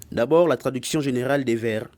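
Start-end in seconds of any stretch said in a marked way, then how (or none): none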